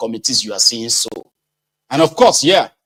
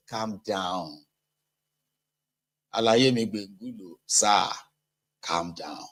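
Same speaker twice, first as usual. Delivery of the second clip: much softer, coaxing calm down; hushed soothing; 4 semitones lower, 9 dB quieter, slower than usual. Retold or imitated. Man hushing a worked-up friend, soft and coaxing. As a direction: settle an agitated man down gently.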